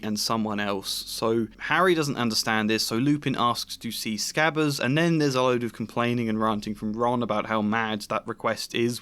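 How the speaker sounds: normal talking voice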